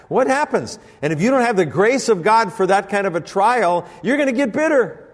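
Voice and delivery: loud, high sermon climax; full-force preaching. Acted. Pastor preaching loud and high to the men.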